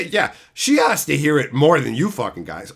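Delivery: usual speaking voice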